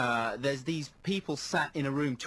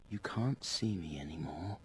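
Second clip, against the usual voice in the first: hypnotic hush; 8 semitones lower, 6 dB quieter than usual. Retold or imitated. Darren hypnotising the film student.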